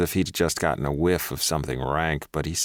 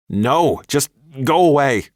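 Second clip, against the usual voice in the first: higher, loud angry rebuke; furious; 9 semitones higher, 8 dB louder, slower than usual. A narrator's reading. Woman telling her ex off for stalking. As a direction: call him out hard. Tells ex to leave.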